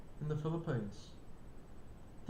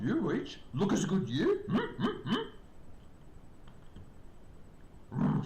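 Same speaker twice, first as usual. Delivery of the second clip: Yoda voice; 2 semitones lower than usual. Pretend. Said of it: "Yeah, Yoda".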